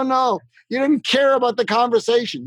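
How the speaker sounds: ordinary talk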